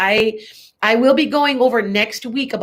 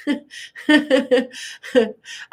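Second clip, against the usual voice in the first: real chuckling laugh, a little higher; amused laugh; 4 semitones higher than usual. Real, not acted. Reader amused by a comment on her accent, chuckling laugh. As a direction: laugh in amusement for a couple of seconds.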